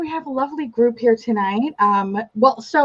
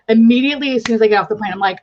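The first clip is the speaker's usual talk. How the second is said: ordinary delivery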